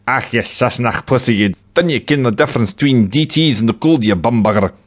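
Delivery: a Scottish tramp snarling insults like an attack dog; barking Scottish tramp. Acted. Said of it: "barked the tramp".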